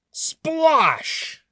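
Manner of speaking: very high splashy effect word; splash. A narrator's reading. Thieves fall in the pond, a high splash. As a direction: make it splash. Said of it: "sound effect in story".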